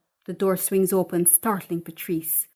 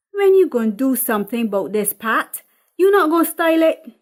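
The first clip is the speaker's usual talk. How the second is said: louder, fast, pushy questions; nagging mother; 5 semitones higher, 8 dB louder, faster than usual. Acted. Mother nagging her daughter about her roots, loud and fast. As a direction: nag her about her hair.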